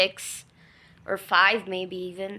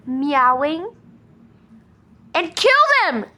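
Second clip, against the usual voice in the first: loud, rising disbelief, peaking high at the end; outraged protest; 6 semitones higher, 6 dB louder than usual. Real, not acted. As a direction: plead against the cruelty, louder and louder.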